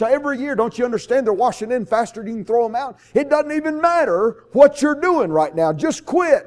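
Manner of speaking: put-on old man urging the boy to give up; exasperated old seaman. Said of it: "He says".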